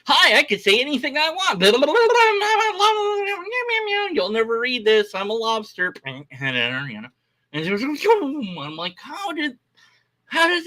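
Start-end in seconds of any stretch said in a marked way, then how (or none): 0.1-5.9 silly chat commenter. Retold or imitated. Typing nonsense into a busy Twitch chat, high goofy babble. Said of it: "and I was like".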